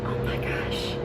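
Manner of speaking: hushed oh my gosh; whispering. Retold or imitated. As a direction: breathe it out in a whisper. Whispered to roommate after meeting.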